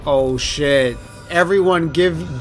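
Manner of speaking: normal talking voice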